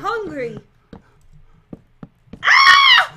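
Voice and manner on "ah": high, loud scream; sudden piercing shriek; startled scream. Real, not acted. Scream on dropping gun.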